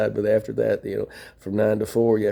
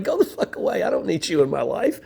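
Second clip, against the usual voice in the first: retold telling off; 7 semitones higher than usual. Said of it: "I'm like".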